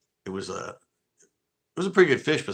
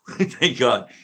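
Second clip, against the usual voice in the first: laughing relief; 3 semitones higher, 8 dB louder, slower than usual. Retold or imitated. Relief after joke revealed.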